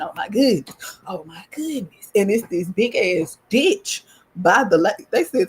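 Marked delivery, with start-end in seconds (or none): 1.1-2.1 breathless hushed whisper. Real, not acted. Narrator hushing the repeat to viewers, in a whisper. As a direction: breathe it out in awe.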